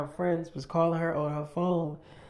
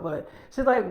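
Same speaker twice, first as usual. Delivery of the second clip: normal talking voice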